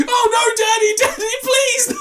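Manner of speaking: high, loud mock wail; hammed-up pleading. Volunteer backstage unaware curtains closed, hammed-up high pleading. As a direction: beg in squealing fake passion, close to laughing.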